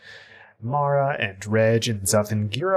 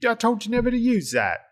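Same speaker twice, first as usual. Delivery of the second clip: high, quick muddled Cockney-Kiwi character voice; high-pitched mock Cockney slash Kiwi telling-off; Cockney-New Zealand accent; 12 semitones higher, faster than usual. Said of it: "they hear this Cockney slash New Zealand accented person".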